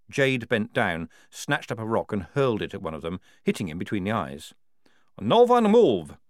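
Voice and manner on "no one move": high, very loud warning; urgent shout. A narrator's reading. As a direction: shout to stop everyone at once. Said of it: "shouted Maledict".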